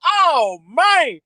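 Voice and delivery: ordinary talk, nothing marked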